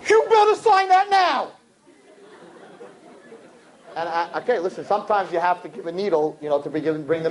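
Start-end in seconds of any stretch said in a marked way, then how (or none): 0.1-1.5 high, loud yell across the room; mother screaming at her son. Retold or imitated. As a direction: shout the order. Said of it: "she screams across".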